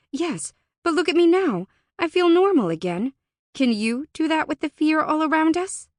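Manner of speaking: high, loud, eager insistence. A narrator's reading. Alora proves herself to the doubtful Fae, eager and insistent. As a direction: press the point eagerly. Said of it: "She nodded eagerly".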